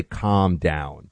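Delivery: low, measured command; calm-down demo. Shown as a demonstration. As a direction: demonstrate telling a partner to settle, pitched well down. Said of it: "Just like that".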